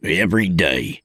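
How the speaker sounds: ordinary delivery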